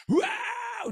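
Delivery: wow melengking sangat tinggi; seruan kaget. Retold or imitated. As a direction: lempar seruan kaget setinggi mungkin. Karyawan menangis memanggil Yesus. (seruan kaget melengking)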